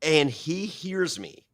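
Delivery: ordinary delivery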